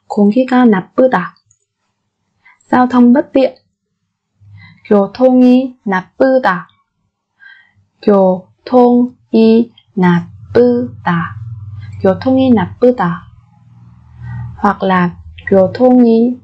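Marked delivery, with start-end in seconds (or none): 0.1-1.3 공기가 나쁘다 said quickly; fast native repeat. Shown as a demonstration. Teacher gives the fluent native-speed version, brisk. 8.0-11.4 교통이 나쁘다 stretched slowly; slow syllable drill. Shown as a demonstration. Teacher drilling learners slowly, syllable by syllable. 12.0-13.3 교통이 나쁘다 said quickly; fast native repeat. Shown as a demonstration. Teacher gives the fluent native-speed version, brisk.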